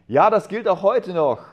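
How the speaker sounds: high, loud burst of conviction; joyful realisation. A narrator's reading. Man in air raid realising the message holds, joyful exclamation. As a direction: exclaim it as a sudden glad discovery.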